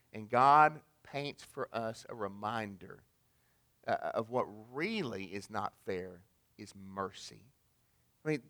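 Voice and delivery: usual speaking voice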